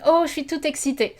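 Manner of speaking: high, quick imitation of an excited girl; mock excited girl. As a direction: gush it like a thrilled girl. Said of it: "a French girl saying".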